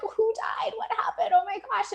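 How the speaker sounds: mock alarm. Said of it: "everyone's like".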